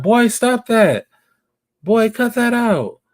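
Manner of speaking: high, slow, teasing woman's voice; mock playful scolding. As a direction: play the woman half-laughing at the boy.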